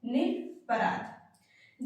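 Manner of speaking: निरपराध spoken very slowly and softly, ending on a bare ध; slow correct pronunciation. Shown as a demonstration. Teacher slowly models the correct word for students. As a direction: draw out निरपराध slowly without a final ई. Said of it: "बल्कि निरपराध होता है".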